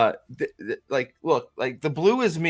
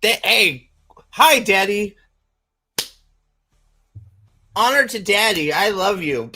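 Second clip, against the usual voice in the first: runt Predator; 5 semitones higher, 3 dB louder than usual. Retold or imitated. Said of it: "He goes".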